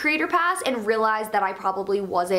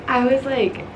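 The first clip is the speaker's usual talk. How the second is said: usual speaking voice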